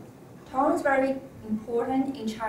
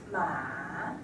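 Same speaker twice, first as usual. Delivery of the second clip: "ma" low dip, then rise; falling-rising tone; 7 semitones lower, 5 dB quieter than usual. Shown as a demonstration. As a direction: drop "ma" low and bring it up. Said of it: "a falling and rising tone".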